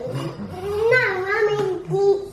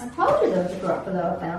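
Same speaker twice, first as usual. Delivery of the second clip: lower, very fast child's attempt at a Scottish accent; a child copying the Scottish narrator of the DVD; copied Scottish accent; 9 semitones lower, much faster than usual. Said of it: "Scottish accent, and so he tries to copy it".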